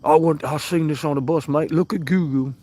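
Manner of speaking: mock British grouch. Streamer imitating grouchy chatter the Divide in a British accent. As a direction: imitate a grumpy British mate. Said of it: "He's like".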